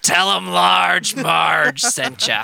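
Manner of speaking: slow, eerie Large Marge. Retold or imitated. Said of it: "Elon Musk's Pee Wee Herman".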